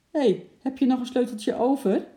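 high, loud call across to grandpa. A narrator's reading. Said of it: "riep hij naar opa Zand".

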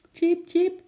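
high, loud little chirping; squirrel chirp. A narrator's reading. Pet squirrel chirping back at the girl.

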